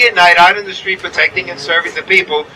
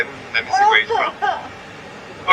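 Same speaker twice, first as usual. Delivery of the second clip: puzzled listener; 12 semitones higher, 7 dB quieter, much slower than usual. Retold or imitated. Listener puzzling over his accent.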